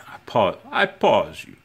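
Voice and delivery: lightly voiced coworker impression; a hint of a friend's voice; Philippe voice. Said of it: "why I use that voice".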